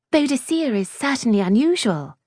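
put-on high-pitched, hasty girlish reply. A narrator's reading. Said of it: "she said hastily".

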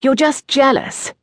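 high-pitched, teasing comeback; lightly acted boyish retort. A narrator's reading. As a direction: fire back at your friend. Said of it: "Vander retorted".

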